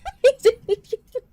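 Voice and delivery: high laugh like a wail; laughing cry. Real, not acted. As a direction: laugh up into a squeal. Laughs teasing a viewer.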